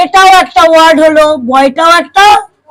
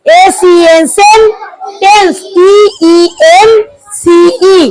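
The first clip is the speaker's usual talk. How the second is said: letter by letter, a pause between each; split into syllables. Shown as a demonstration. Teacher to class, breaking sentence into syllables, paused letter by letter. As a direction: spell "এ সি এন সেন" then "tense, টি ই এন সি ই", pausing after each letter. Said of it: "ভেঙে ভেঙে তোমরা সিলেবেলে ভাগ করে বলবে".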